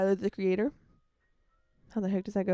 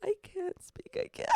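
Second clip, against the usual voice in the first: mock lost voice; 11 semitones higher, slower than usual. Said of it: "I wanna be that girl who's like".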